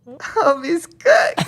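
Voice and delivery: high, very slow stretched verdict; slow high teasing call; drawn-out sing-song. Pretend.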